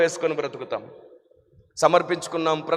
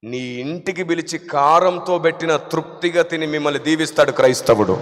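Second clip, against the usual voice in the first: normal talking voice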